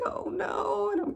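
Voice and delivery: high-pitched alarmed cry, acted out; reliving a moment of dismay; high, dismayed exclamation; panicked. Acted panic in retold vision.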